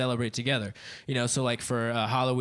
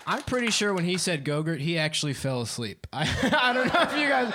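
ordinary talk

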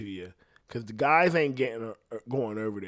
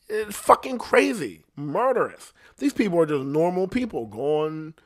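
much higher and louder, indignant and disbelieving; outraged rant; 9 semitones higher, 6 dB louder than usual. Real, not acted. Ranting to the listener about the Cleveland kidnapping, outraged and loud. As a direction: let the outrage burst out, voice climbing.

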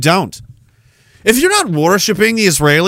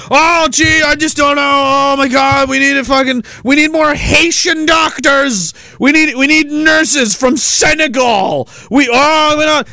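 mock panicked officials; 8 semitones higher than usual. Nova Scotia officials complaining about no doctors, hammed-up panic. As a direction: ham up helpless government worry.

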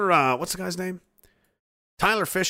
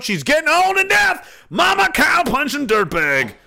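high, slow, loud birthday shout-out; 12 semitones higher, 5 dB louder than usual. Real, not acted. Streamer shouting a birthday greeting to a viewer's deaf mother. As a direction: call it out loud so a deaf mom hears. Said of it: "emphasis on shout".

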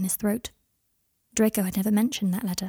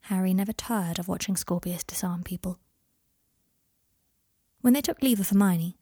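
ordinary delivery

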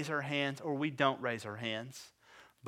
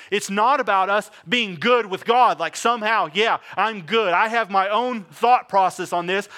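louder, driving preacher voice; insistent preaching, louder; 5 semitones higher, 8 dB louder than usual. Acted. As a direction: push it at them. Preacher builds again.

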